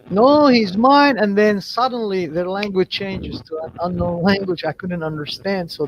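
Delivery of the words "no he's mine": firm, drawn-out protest, pitched up; defiant refusal. Retold or imitated. Princess claims him from the three men, defiant. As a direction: refuse slowly and possessively, a notch louder. Goddess refusing the men's demand.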